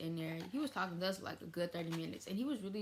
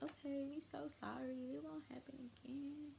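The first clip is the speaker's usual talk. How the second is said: mock contrite apology; 8 semitones higher, 7 dB quieter than usual. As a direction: imitate sheepish kids promising to behave. Teens apologise to uncle in retold scene.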